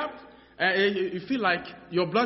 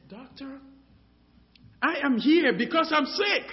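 insistent complaint; 9 semitones higher, 4 dB louder than usual. Retold or imitated. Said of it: "I said".

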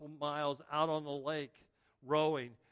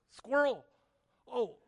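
mock distracted; 9 semitones higher, 6 dB louder than usual. Mimics distraction joke.

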